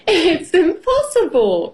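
high, lightly amused scoff; amused exclamation. A narrator's reading. Miss Rabbit scoffs at hooking a duck, amused and high. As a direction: call it out high with mild amusement. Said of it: "laughs Miss Rabbit".

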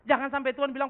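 high, loud pulpit voice; full-force sermon delivery; fiery preaching. Acted. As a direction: drive the point home to the congregation.

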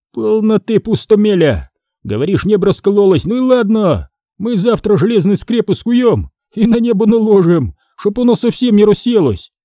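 high-pitched, scoffing smith; Фома, насмешливый кузнец. Acted. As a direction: brush off the apprentice's fears, mocking. (насмешливо, высоким голосом)

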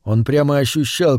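ordinary delivery

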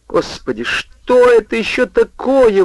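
thin high squeaky cry of alarm; pathetic squeaky wail. A narrator's reading. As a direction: squeal it out, pitiful and panicked. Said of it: "возопил он жалким писклявым голосом".

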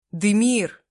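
loud high frightened cry; panicked shout. Acted. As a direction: call his name in panic. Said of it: "в панике воскликнула я".

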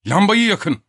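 high, loud command; shouted order. Acted. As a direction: call it through a closed door. Shouted order through the door. (yüksek sesle emir)

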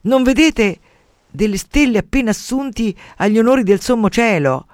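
loud, high, indignant question; furious goddess. A narrator's reading. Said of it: "andò su tutte le furie". (protesta furiosa, voce alta)

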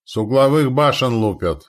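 loud urgent shout. A narrator's reading. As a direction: yell the warning. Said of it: "крикнул Ратиша".

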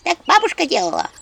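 high squeaky imp voice; squeak. Acted. Imp Anchutka squeaking instructions while running around. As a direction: squeak it out quickly.

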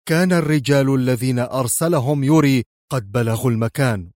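ordinary delivery, nothing marked

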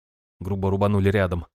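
ordinary delivery, nothing marked

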